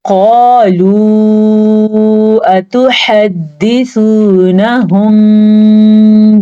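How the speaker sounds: slow, drawn-out tajweed chanting; Quranic recitation. Shown as a demonstration. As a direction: recite slowly, stretching each vowel. Recites the verse. (धीमी तिलावत)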